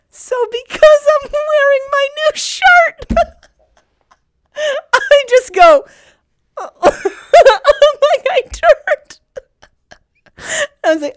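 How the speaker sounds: high-pitched tearful laughter through the words; can't get it out for laughing; laughing hard. Real, not acted. Breaks down laughing telling it.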